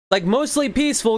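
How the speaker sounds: high mocking singsong, sarcastic; mock the phrase in a high sneering voice.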